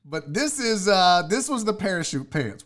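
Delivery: normal talking voice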